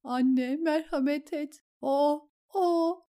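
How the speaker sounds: high pleading voice, dissolving into sobs; breaking into sobs. Acted. Grieving lady begs the Mother for mercy, sobbing. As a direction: cry out for mercy until the words break. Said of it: "Hıçkırıklara boğuldu".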